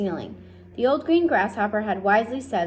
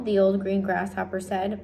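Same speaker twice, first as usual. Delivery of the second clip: ordinary delivery, nothing marked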